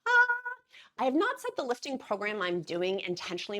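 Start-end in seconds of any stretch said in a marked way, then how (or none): 0.1-0.5 excited squeal. Real, not acted. Squeal over lifting clip.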